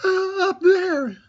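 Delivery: very high, slow, shaky squeak; frightened Piglet. A narrator's reading. Piglet points to where the Heffalump is, high and slow with fright. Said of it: "said Piglet, waving his paw".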